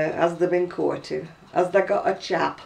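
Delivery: "Where's tha been?" in broad Oldham dialect, tha short and unstressed. Shown as a demonstration. Showing her friend the old Oldham way of speaking, broad Lancashire. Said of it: "That means".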